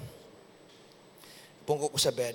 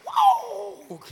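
high loud shout of joy; exultant whoop; 14 semitones higher, 6 dB louder than usual. Real, not acted. Preacher whooping after the declaration, loud and high.

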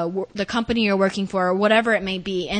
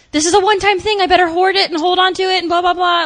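anxious inner voice; 9 semitones higher, 6 dB louder, faster than usual. Retold or imitated. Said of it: "it's just like".

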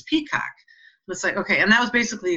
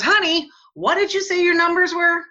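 high, louder sudden question; surprised husband; 12 semitones higher, 5 dB louder than usual. Retold or imitated. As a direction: quote him caught off guard, pitch up. Husband quoted hearing lottery numbers.

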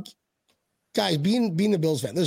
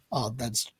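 ordinary talk, nothing marked

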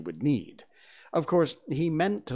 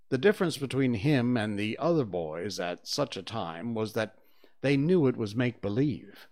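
ordinary delivery, nothing marked

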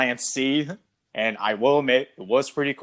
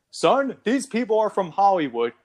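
acted dad line; 9 semitones higher than usual. Acting the dad's line.

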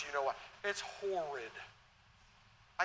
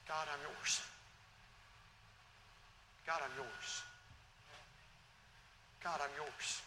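softly, slowly surrendering; soft repeated prayer; 3 semitones lower, 10 dB quieter, slower than usual. Acted. Preacher praying quietly before the congregation, soft and surrendering. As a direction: let each line sink lower and softer.

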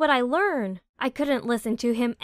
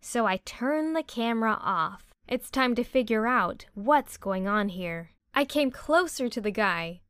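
usual speaking voice

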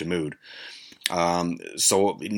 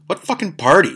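high, louder retold disbelief; incredulous; 11 semitones higher, 5 dB louder than usual. Narrator reacting to friend's party plan, incredulous. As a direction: throw the question out in mock outrage.

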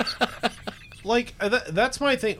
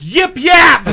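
mock holler; 11 semitones higher, 12 dB louder than usual. Mocking the holler.

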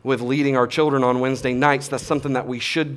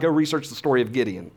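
ordinary talk, nothing marked

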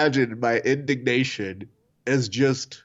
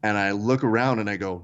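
normal talking voice

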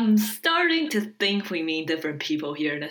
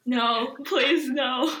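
pleading; 8 semitones higher, much slower than usual. Acted. Clint begs Natasha not to.

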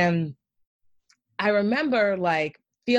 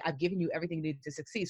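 soft voice of God answering her; calm inner voice; 2 semitones lower, 9 dB quieter, faster than usual. Retold or imitated. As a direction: speak as a quiet inner voice of reassurance. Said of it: "I kept feeling".

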